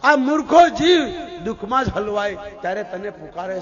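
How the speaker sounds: loud opening cry, easing after each; impassioned reproach. Retold or imitated. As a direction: hit each address to the soul hard. Kunta rebukes the forgetful human soul.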